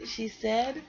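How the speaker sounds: put-on lively read-aloud for kids; storybook reading. A narrator's reading. Parent reading a bedtime story to kids, lively storybook voice. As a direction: read it like a picture book to children.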